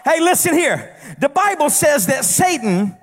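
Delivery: rising, shouted sermon cadence in a long crescendo; full-force preaching. Acted. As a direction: preach it hard and build the congregation up.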